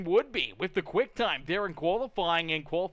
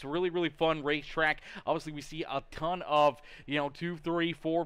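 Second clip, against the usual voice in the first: ordinary delivery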